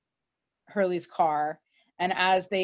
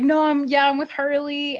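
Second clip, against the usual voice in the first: higher, louder flustered reply; 7 semitones higher, 4 dB louder than usual. Retold or imitated. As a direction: stumble out an excited answer. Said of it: "he's like".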